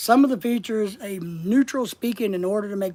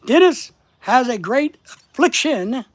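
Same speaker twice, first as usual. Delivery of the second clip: "Dennis", "great" and "affliction" punched loud and higher; emphatic word stress; 4 semitones higher, 7 dB louder than usual. Shown as a demonstration. Speaker making an example sentence for viewers, emphatic stress on "Dennis" and "affliction". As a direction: hit "Dennis" and the middle of "affliction" hard. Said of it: "emphasizing part of the word to make my point".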